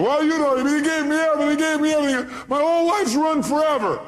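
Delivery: high, loud hammed-up lament; mock distraught wail. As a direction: wail it like a dumped drama queen. Voicing a distraught jilted woman.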